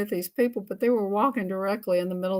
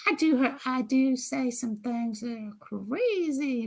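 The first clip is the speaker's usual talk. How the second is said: usual speaking voice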